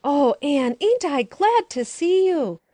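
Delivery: put-on high, loud, slow child's shout of joy; boy bursting with delight; joyful yell. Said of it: "with a joyful yell".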